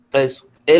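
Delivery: ordinary talk, nothing marked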